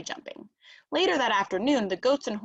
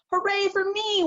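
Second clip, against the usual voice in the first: much higher, triumphant cheer; gleeful shout; 11 semitones higher, 3 dB louder than usual. A narrator's reading. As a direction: crow over winning from the tractor top. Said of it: "Wing shouted".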